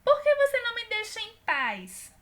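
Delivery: high, loud fed-up plea; exasperated character. Acted. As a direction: let her snap back at the pest. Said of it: "E por fim ela diz".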